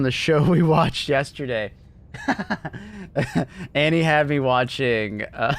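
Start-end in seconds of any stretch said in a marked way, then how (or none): 2.1-3.7 high-pitched amused laugh. Real, not acted. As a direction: burst into high laughter at the request. Amused by accent request.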